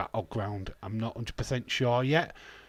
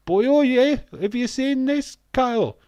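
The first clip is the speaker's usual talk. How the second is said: loud, high-pitched mock Welsh accent; hammed-up Welshman calling out, accent slipping towards Geordie; bad Welsh accent; 13 semitones higher, 11 dB louder than usual. Streamer imitating shocked Welsh viewers at tea time, bad Welsh accent. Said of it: "I can't do a Welsh accent".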